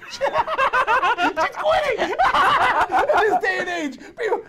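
high, slow, laughing scornfully; mocking laughter. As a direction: cackle it out in disbelief.

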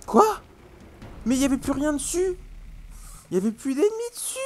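high, astonished disbelief; can't believe your eyes; surprised. Real, not acted. Surprised enemies gone.

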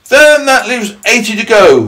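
high, loud, slow centipede. Acted. Centipede teasing about boots left, high and loud. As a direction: call out the total high and drawn-out. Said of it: "the centipede said".

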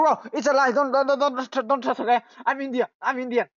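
ordinary talk, nothing marked

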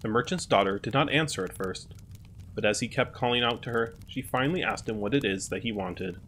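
ordinary delivery

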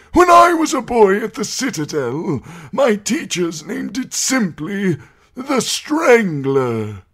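put-on high, loud quavering old voice; frail scholar lecturing the court; old maester. A narrator's reading. Pycelle voiced in narration.

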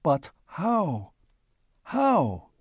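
can't grasp it; stunned, pleading how; baffled. A narrator's reading. Stunned stranger asks how.

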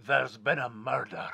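husband tries the hotel's Scottish theme in a mock accent.

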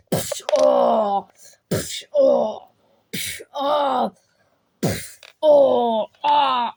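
puffed blows with crying aa yells; pained fight cries. Pretend. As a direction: play the one taking the punches. Mock fistfight sound effects. (acı çeken dövüş çığlıkları)

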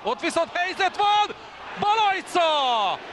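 high shout, name drawn out slowly; excited Hungarian sports commentary. Real, not acted. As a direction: yell the chance, stretch the keeper's name. Chance and save, live. (izgatott sportkommentár)

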